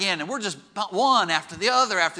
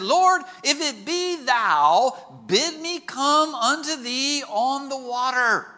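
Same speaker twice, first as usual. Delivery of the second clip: slow, drawn-out raised call; 5 semitones higher, much slower than usual. Acted. Peter calls to Jesus from the boat, calling out. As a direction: call it high across the water, stretching each word.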